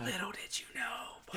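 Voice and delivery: breathy knowing whisper. Retold or imitated. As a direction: whisper it like a secret. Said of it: "I'm like".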